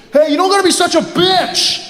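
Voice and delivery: high, indignant shout; creep lashing out. Acted. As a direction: snap at her like a rejected creep. Rejected creep lashes out.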